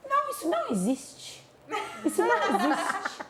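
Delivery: high, laughing disbelief; childish disbelief. Retold or imitated. Nanny retells the boy's disbelief, high and laughing. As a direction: play the boy refusing to believe.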